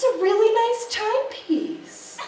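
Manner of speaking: high, slow put-on voice with a laugh; hammed-up fancy stranger; mock posh compliment. Comic to the crowd, in a high put-on voice.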